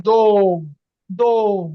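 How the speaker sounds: দোম said loud, the ও full and clear; teacher stressing the ও of দোম. Shown as a demonstration. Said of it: "এখানে stress কিন্তু ডি আর ও".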